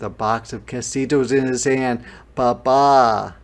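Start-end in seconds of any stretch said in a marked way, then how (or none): 2.3-3.4 loud, drawn-out happy exclamation; big glad shout; delighted call. Acted. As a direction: announce him as a joyful surprise. Delighted surprise at seeing father.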